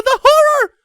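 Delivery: shrill wail, horror falling away; terrified wail, falling. Acted. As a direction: wail it again, dropping at the end. Ponies shrieking in terror.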